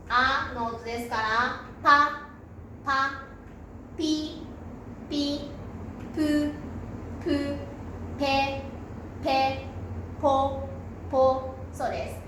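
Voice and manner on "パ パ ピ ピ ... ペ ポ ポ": パ as P plus A, each syllable said separately; P-sound demo. Shown as a demonstration. Teacher showing learners P plus vowel sounds, separated syllables. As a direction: model パ, ピ, プ, ペ, ポ one by one, each repeated. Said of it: "Pと アの音ですから".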